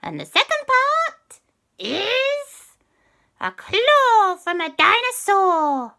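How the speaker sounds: very high, slow, loud witchy bargain; sly and drawn out, bargaining; high cat witch. Acted. Cat witch demanding a favour from the children, high and sly.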